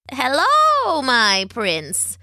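high swooping witch greeting that drops away; drawn-out storybook crone voice; croaking witch. A narrator's reading. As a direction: croak a mock-sweet welcome. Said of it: "the witch croaked".

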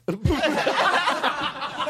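high-pitched burst of laughter; high delighted laugh. Real, not acted. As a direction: crack up at the joke. Laughing at the punchline.

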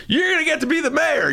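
very high mock voice; mock offer. Mocking handed-over mayorship.

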